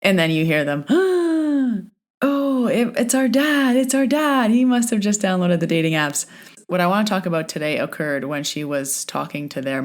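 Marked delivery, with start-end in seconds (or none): 0.9-1.9 sharp high gasp of realization; shocked gasp. Retold or imitated. Daughters realise it's their dad. 2.2-6.3 much higher, stunned realization; shocked daughters. Retold or imitated. Daughters realise it's their dad.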